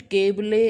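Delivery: केबले with the silent E voiced as "lay"; sounded final E. Shown as a demonstration. Teacher shows students what does not happen, केबले with final E pronounced. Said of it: "ऐसा नहीं होता".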